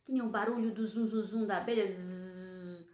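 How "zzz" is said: "Zzz" as a low, soft bee buzz. Shown as a demonstration. Teacher imitating a bee to show the Z. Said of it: "é o som do Z".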